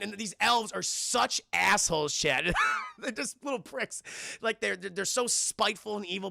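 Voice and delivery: ordinary delivery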